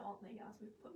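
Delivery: whispered aside to the crew. Real, not acted. Host checking quietly with crew whether pictures are uploaded, whispered. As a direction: drop to a quick whisper.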